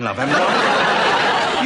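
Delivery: slow, chuckling love haven't I; laughing. Real, not acted. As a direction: laugh it off to the wife. Chuckles while scolding his wife.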